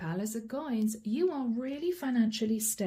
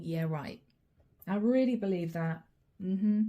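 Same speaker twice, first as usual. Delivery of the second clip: dry, lower-pitched sarcasm; sarcastic disbelief; 3 semitones lower than usual. Acted. Client looking at the partner's excuses, sarcastically disbelieving. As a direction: think it with mock agreement, unconvinced. Voices a sceptical client's thoughts.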